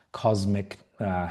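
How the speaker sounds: usual speaking voice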